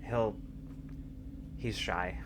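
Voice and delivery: he'll, he's shy, half-whispered and gentle; a mother's quiet aside about her shy son; soft hushed aside. Acted. Maya to Mason about their son, a soft half-whisper.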